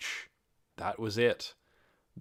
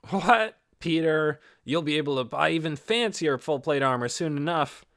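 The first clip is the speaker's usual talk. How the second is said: louder, higher, cheerful ribbing; higher and louder jokey companion; Lukrut's voice; 5 semitones higher, 5 dB louder than usual. A narrator's reading. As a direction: call out to a friend with a grin. Narrator voices Lukrut teasing.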